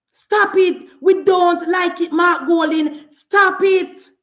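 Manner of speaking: high, punchy public telling-off; emphatic scolding. Real, not acted. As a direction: scold a rival forcefully from the platform. Scolding a political rival at rally.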